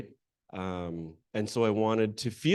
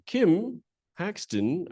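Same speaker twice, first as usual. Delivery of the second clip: higher, slow call with a rising end; 8 semitones higher, much slower than usual. Real, not acted. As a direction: call the name out across the room. Calling her into the room.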